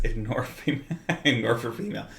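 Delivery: real amused chuckle through the words; laughing. Real, not acted. Presenter amused by his table's bearded female gnome, laughing. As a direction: chuckle while trying to explain.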